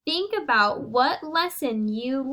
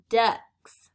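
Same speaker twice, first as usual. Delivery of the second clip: ducks said louder, with the final k crisp; stress on ducks; 3 semitones lower, 4 dB louder than usual. Shown as a demonstration. Teacher models the k sound for the class, stressing ducks. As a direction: hit ducks and sharpen its k. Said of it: "I heard the k sound in the word".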